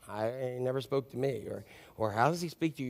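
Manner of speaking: low, hushed aside of a doubter; doubtful mutter. Acted. Listener doubting that God speaks to them, muttered low.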